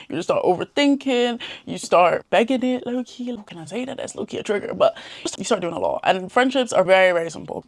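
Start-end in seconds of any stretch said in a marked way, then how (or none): 2.9-4.7 drops to a near-whisper; quiet aside. Real, not acted. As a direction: say it under your breath, hushed.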